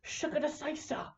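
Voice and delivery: high, far-off mock elf yell; squeaky hammed-up elf war cry; playful elf battle shout; mock Legolas battle cry. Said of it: "who's just like".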